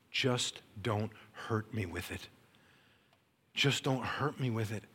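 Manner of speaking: soft, halting plea to God; hushed plea. Retold or imitated. Speaker pleads with God not to hurt them, hushed plea. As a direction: plead quietly, word by word.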